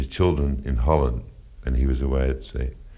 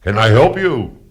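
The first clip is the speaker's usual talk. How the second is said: put-on German accent; 7 semitones higher, 8 dB louder than usual. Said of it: "in a deep, guttural German accent".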